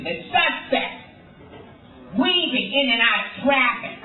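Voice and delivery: angry TV narrator. Acted. Crime show narrator describing a suspect, mock angry. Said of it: "He's always so angry".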